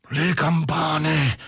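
slow, high moan of a madman; wailing moan. A narrator's reading. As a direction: wail it, drawing it out high. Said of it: "mogolava il pazzo".

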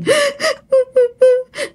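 a high eerie wail of a crying ghost; imitated ghostly wailing. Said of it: "càng lúc càng khóc lớn hơn".

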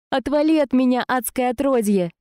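high furious outburst; angry shout. Acted. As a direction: shove him off with your voice. Heroine fighting off demon.

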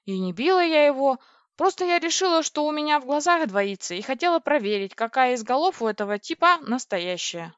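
agitated, embarrassed excuse; flustered. A narrator's reading. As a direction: justify yourself in a fluster. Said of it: "смутилась я".

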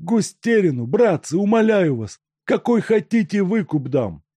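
high, desperate voice of a bound man; begging captive. A narrator's reading. Makarka begs the gang to let him go, desperate. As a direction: beg them not to hand you over.

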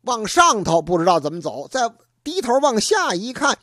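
usual speaking voice

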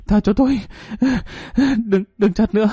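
high, crying, begging for mercy; sobbing plea. A narrator's reading. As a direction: beg through tears. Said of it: "khóc lóc van xin".

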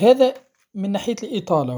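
ordinary talk, nothing marked

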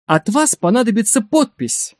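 high-pitched, excited announcement; elated exclamation. A narrator's reading. Biographer bursting into the director's office, elated. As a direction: burst in glowing with news. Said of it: "воскликнул он".

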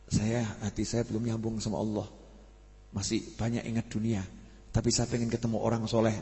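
quiet, earnest self-admission; soft humble confession. Retold or imitated. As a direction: speak it low like a private confession. Voicing a humble seeker's thoughts. (pengakuan lirih dan rendah hati)

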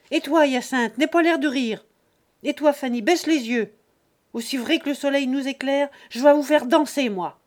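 loud, high domineering roar; furious threat. Acted. Father threatening each of his children, loud and furious. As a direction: dominate the whole family.

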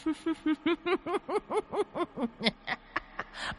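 high shrill demonic cackle; evil cackle. Acted. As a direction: cackle down at him, gloating. Demon woman laughs at victim. (tiếng cười the thé của nữ quỷ)

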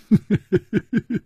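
high cheeky giggle; gleeful laugh. Acted. Page boy laughing after getting the treasure. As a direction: laugh in triumph.

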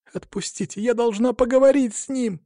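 panicked cry. A narrator's reading. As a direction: plead to be let go in a high panic. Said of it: "в панике закричала Алина". (панический женский крик высоким голосом)

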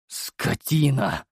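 slow, biting curse; cursing. Acted. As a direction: spit out the insult. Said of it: "выругался я".

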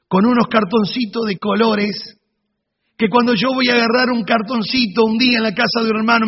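ordinary talk, nothing marked

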